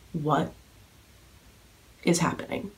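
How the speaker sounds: breathless whisper, almost no voice; stunned whisper. Retold or imitated. Fan watching Amira walk over, stunned whisper. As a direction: whisper it in disbelief as she walks over. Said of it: "because I was like".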